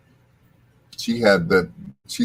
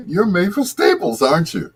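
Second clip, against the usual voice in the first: starstruck, pitched way up high; voice shooting up; 10 semitones higher than usual. Retold or imitated. Meeting [name] in the sandwich line, voice jumps high. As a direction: squeak it out like a starstruck fan. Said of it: "my voice went up twenty octaves".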